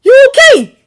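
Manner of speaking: high, loud worried call; urgent call. A narrator's reading. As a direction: call out to him in alarm. Urgent check on fallen friend.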